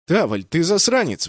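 loud outraged cry; indignant. A narrator's reading. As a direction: blurt it out indignantly. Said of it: "возмутился я". (возмущённо)

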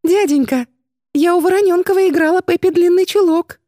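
put-on high little-girl voice, pleading; thin childish voice. A narrator's reading. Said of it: "проговорила тонким детским голоском".

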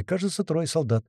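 usual speaking voice

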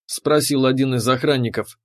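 usual speaking voice

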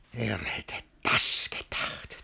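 slow hushed whisper; whispered aside. Acted. Master brooding alone, slow whisper. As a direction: breathe it to yourself.

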